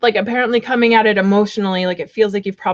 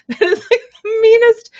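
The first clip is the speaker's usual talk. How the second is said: higher, louder laughing outrage; incredulous squeal; 12 semitones higher, 6 dB louder than usual. Real, not acted. Reader squealing at the viewer's rude card. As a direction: squeal it in delighted shock.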